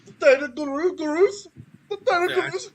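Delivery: high, loud mock Israeli accent. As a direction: ham up an Israeli accent, mangling "tentacles". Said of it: "something like that".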